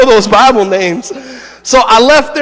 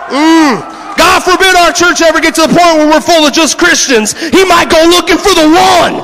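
full-force preaching; 8 semitones higher than usual. Acted. Pastor shouting to his congregation about the lost one. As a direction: preach it to the rafters, building fire.

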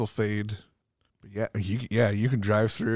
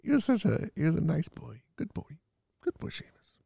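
higher, softer, tender praise to a dog; fond dog talk; 6 semitones higher, 5 dB quieter than usual. Real, not acted. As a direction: coo like praising a good dog. Fondly talking to dog memory.

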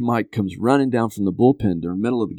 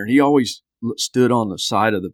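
ordinary talk

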